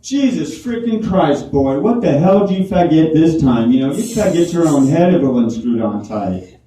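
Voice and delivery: put-on a loud, exasperated Georgia father scolding his boy; Georgia dad rant. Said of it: "he's from Georgia".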